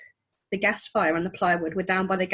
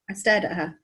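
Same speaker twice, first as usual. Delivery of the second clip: plain reading voice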